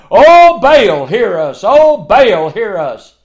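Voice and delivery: loud, high mock wail to an idol; mock crying out. Prophets of Baal wailing at Elijah's altar, mock loud cry. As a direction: ham up the prophets' desperate cry. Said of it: "they're crying out".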